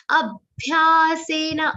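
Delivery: Abhyasena with the a pressed into bha; stress before bha. Shown as a demonstration. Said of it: "a has a stress of bha".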